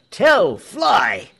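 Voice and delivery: high, loud warning shout; urgent cry. A narrator's reading. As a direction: cry out sharply for someone to flee. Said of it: "he cried".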